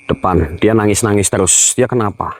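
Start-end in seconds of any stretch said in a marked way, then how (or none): none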